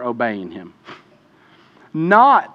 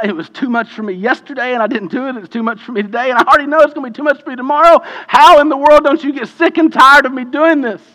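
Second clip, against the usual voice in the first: starts pleading, then climbs much higher, louder and x2.0 fast; desperate plea; 8 semitones higher, 6 dB louder than usual. Acted. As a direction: pour out the frustration until it bursts. Voicing a desperate believer's plea.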